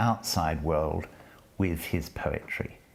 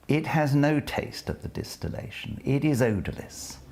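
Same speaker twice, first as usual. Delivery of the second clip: poetry recitation; 3 semitones higher than usual. Acted. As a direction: savour each line as you read it aloud. Lecturer reads the poem.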